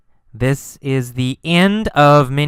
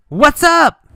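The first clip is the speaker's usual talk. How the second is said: high, raised call out to someone; yelled; 11 semitones higher, 4 dB louder than usual. Acted. Carlos calling to the girl on a bicycle, yelled. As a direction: call it out high, like a yell across the street. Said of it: "He yelled".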